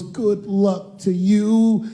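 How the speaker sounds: drawn-out, very slow goodbye; slow tender farewell. Retold or imitated. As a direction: stretch it out like a long hug. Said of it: "She goes".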